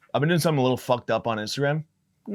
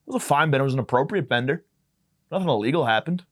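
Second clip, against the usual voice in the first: ordinary delivery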